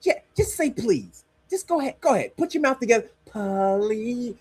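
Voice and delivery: high, mock-coaxing talk to a child; condescending America. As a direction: coax like talking down to a kid. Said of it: "what America is telling Mexico".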